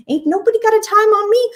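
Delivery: sassy meme voice. Pretend. Quoting a meme line.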